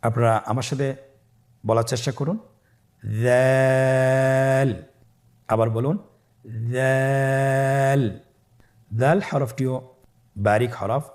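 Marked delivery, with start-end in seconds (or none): 3.0-4.7 দাল held long for three alif lengths; দাল drawn out long, a teacher's model; drawn-out letter. Shown as a demonstration. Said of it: "অবশ্যই তিন আলিফ টেনে পড়তে হবে". 6.7-8.0 দাল held long for three alif lengths; দাল drawn out long, a teacher's model; drawn-out letter. Shown as a demonstration. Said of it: "অবশ্যই তিন আলিফ টেনে পড়তে হবে".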